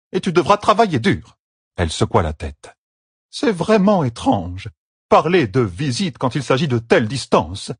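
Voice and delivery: high, odd elder voice, firm; stern Aiel elder. A narrator's reading. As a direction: lay down the hard truth. Nadere lectures Avienda.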